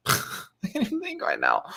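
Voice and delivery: laughing while talking; giggly, high and breathy. Real, not acted. Laughing at own blank mind.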